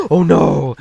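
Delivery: mock gasp. Mock shock at stolen color.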